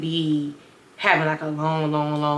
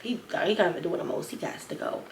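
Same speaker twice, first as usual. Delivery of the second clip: whispering; 7 dB quieter than usual. Retold or imitated. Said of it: "everybody was just looking like".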